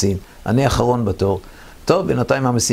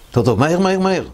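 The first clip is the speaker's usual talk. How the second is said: hurried urging; 8 semitones higher, faster than usual. Retold or imitated. Commander hurries the tanker under artillery, urgent call. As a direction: push him to hurry, voice up.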